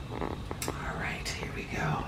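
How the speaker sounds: breathy whisper to herself before starting; a hushed self-cue before the reading begins. Real, not acted. Whispering to herself before reading to the class.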